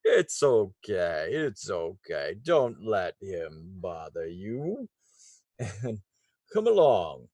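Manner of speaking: jolly booming laugh easing to calm; kindly Santa voice; gentle Santa-like voice. Acted. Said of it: "the gentle voice say".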